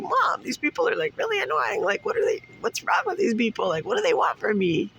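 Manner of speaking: high whining to mom; whiny complaint. Retold or imitated. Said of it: "I'm like".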